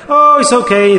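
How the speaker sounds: mock carefree dismissal, pitched high and loud; careless brush-off. Preacher mimics listeners shrugging off warnings, breezy mock dismissal. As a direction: toss it off like nothing matters.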